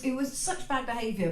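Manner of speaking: usual speaking voice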